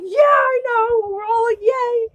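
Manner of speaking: high, drawn-out happy cheer; excited cheering. Retold or imitated. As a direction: gush it high, slow and giddy. Retold shared excitement about going along.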